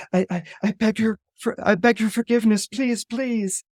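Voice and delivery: high, hurried, stammering supplication; pleading. Acted. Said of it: "she sees her crying out".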